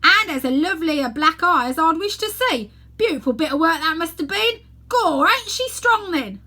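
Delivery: high, loud cockney gawker, slowing to relish it; gleeful onlooker. Acted. Bystander in the crowd admiring the shiner, gleefully loud.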